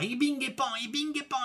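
high, rhythmic sing-out of whacks; excited storybook beating; imitated blows. A narrator's reading. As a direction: bounce through the hits at a high pitch. Narrator acts out the blows.

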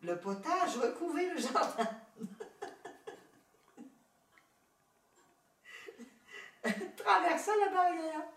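high-pitched narration broken by real laughs; real laughing. Real, not acted. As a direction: narrator cracking up mid-story, high and loud. Narrator cracks up while reading.

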